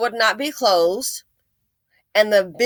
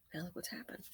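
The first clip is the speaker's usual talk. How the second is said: hushed, breathy aside; soft whispered aside, almost to yourself; 4 semitones lower, 24 dB quieter, faster than usual. Real, not acted. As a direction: whisper it like a grim secret. Hushed aside after "You go, girl.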